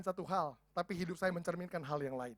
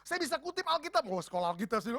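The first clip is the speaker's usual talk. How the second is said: ordinary talk, nothing marked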